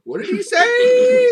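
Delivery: high laughing shout. Real, not acted. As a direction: crack up while asking it. Laughing disbelief at the story.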